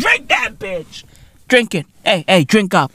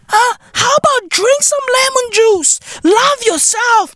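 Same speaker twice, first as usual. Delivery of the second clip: high mock preach; 13 semitones higher than usual. Ranting advice at dieters.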